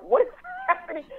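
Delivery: high, startled squeak of confusion; a sudden high bewildered question; baffled. Retold or imitated. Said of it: "And I was like".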